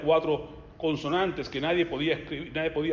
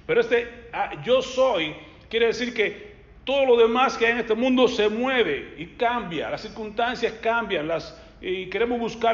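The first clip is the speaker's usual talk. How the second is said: preaching loud; 6 semitones higher, 5 dB louder, slower than usual. Real, not acted. Preacher rises to full force.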